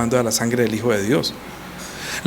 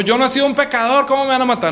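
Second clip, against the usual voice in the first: high, protesting voice of a wrongly accused man; mock outrage at being condemned; a hammed-up cry of innocence; indignant protest; 9 semitones higher than usual. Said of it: "él no dijo".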